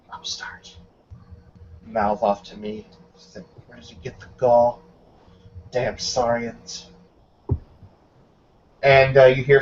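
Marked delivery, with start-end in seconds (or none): 0.1-6.9 quiet, irritated grumbling; grumbling captain. Acted. The captain grumbles to himself, quiet and irritated. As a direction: mutter the complaints under the breath.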